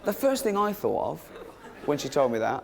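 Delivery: ordinary talk, nothing marked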